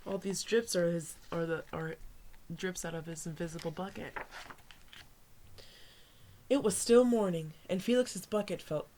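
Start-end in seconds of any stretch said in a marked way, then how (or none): none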